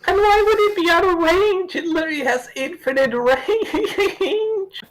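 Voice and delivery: very high, squeaky disbelief breaking into laughter; laughing, exasperated nitpick; incredulous. Real, not acted. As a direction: protest in a high voice, then crack up. Protests the story's logic.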